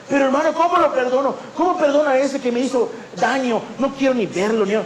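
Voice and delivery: high, pleading voice of an offended church member; hurt protesting congregant. Retold or imitated. Offended member protesting to the pastor about forgiving, in a high pleading voice. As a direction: whine it back at the pastor, unwilling to forgive.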